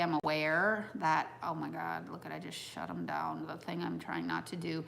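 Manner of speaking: normal talking voice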